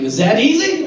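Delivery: high, loud incredulous question; loud, high-pitched surprise; mock astonishment. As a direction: burst out in disbelief. Acted astonished reply to dad.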